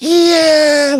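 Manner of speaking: a high, loud stretched-out yell; mock scream. Boss yelling after him as he jogs off, a high mock scream. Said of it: "like, screaming at me".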